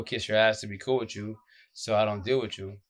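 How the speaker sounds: ordinary talk, nothing marked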